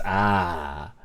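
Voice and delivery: ordinary talk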